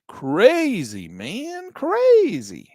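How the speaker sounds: high, drawn-out hippie "crazy, man"; stoner voice. Pretend. Stoner voice after "smoking weed.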